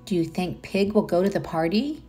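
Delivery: usual speaking voice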